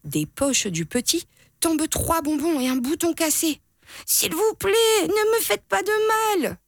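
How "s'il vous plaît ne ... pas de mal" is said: put-on very high, scared little kid; pleading. A narrator's reading. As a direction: beg like a terrified child. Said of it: "supplie Petit Biquet".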